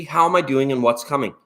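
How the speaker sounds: ordinary talk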